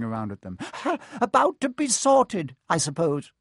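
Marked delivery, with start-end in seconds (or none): 0.6-1.1 jolly chuckle. A narrator's reading. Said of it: "the fat friar, smiling". 1.2-3.3 much higher and louder, cheerful; jolly booming friar. A narrator's reading. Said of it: "the fat friar".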